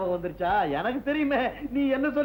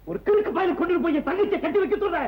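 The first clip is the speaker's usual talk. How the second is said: raised, heated voice; indignant high protest; angry refusal; 7 semitones higher than usual. Acted. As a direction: shout him down in outrage. Brother angrily refuses the match.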